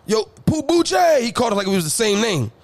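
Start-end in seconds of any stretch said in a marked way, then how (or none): 0.0-1.2 high, slow drawn-out call; dad summoning his sons with a long high call. Retold or imitated. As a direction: holler the boys' names across the house. Dad calling sons from afar.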